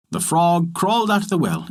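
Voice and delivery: ordinary delivery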